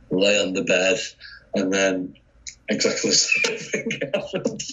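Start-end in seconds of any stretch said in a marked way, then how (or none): 2.7-4.6 laughing. Real, not acted. Laughing while recounting.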